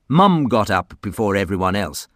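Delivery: usual speaking voice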